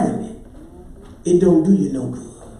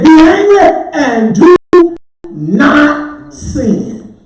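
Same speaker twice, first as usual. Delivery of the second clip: high preacher's shout, slowing down; loud, ringing pulpit cry; proclaimed scripture; 8 semitones higher, 10 dB louder, much slower than usual. Real, not acted. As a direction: belt out the verse with long held words. Preacher proclaiming the verse once more.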